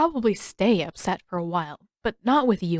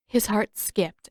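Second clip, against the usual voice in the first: usual speaking voice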